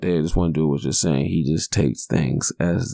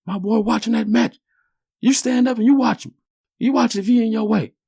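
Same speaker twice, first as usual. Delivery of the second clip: dad snapping back. Retold or imitated. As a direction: bark it back like a protective father. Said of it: "my daddy like".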